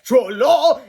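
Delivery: loud high-pitched superhero cry; heroic yell. Acted. Reader shouting the hero's cry. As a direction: belt it out like a cartoon hero.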